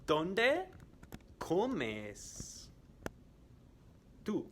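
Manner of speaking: slow and split up for learners; slow quiz question. Shown as a demonstration. Teacher quizzing students, slow and split up. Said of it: "I am going to ask some questions".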